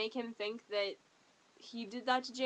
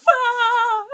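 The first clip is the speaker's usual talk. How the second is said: high loud squeal of delight; happy scream; 11 semitones higher, 16 dB louder than usual. Real, not acted. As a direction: shriek with pure joy. Delight about the play.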